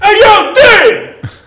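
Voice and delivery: high yelled "Hey, yo, Dave!"; shouted call. Retold or imitated. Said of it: "Be like".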